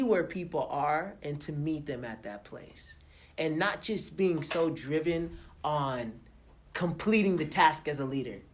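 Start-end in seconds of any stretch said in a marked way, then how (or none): none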